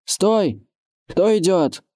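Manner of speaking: high-pitched hail from the wall; sentry's challenge. A narrator's reading. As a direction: challenge the strangers in a high call. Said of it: "окликнул дозорный".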